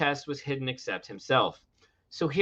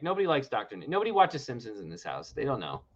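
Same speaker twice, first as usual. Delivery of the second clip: normal talking voice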